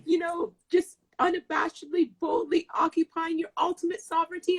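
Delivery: high-pitched, worked-up rush of amazement; retold losing it. Retelling how the poem hit her, losing her mind. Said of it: "I was losing my mind".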